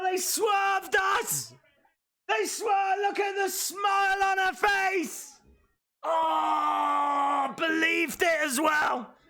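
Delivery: high, real excited shouts; excited shouting. Real, not acted. Fan thrilled the wrestlers fooled everyone, excited shouting. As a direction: yell with delight at the twist.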